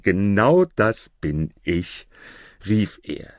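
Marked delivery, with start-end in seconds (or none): none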